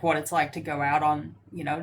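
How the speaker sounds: normal talking voice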